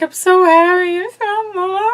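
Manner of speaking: high, loud, hammed-up sob through the words; mock crying. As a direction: imitate a contestant blubbering with joy. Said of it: "breaks down over it and starts crying".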